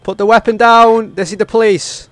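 loud, high, slow command; police shout. Acted. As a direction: shout it at the suspect. Cop shouting at suspect.

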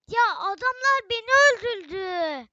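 loud, high outburst of annoyance; real exasperated shout; frustrated yell. Real, not acted. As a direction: yell at the game for killing you. Killed in game, frustrated yell.

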